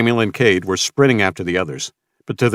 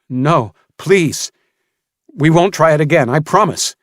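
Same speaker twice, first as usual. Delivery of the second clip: high, loud desperate begging; desperate pleading; 8 semitones higher, 6 dB louder than usual. Acted. Samuel begging Mason for mercy, desperate pleading. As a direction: beg him to stop.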